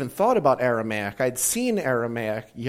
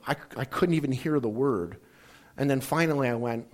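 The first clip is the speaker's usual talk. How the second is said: usual speaking voice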